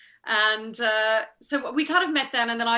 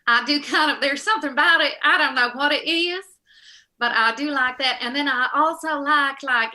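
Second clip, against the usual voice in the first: Southern accent; 3 semitones higher, 5 dB louder than usual. Shown as a demonstration. Actor shows off favorite accent to fan, Southern accent. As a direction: put on a Southern US accent, pitched up a little. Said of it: "myself a little Southern accent".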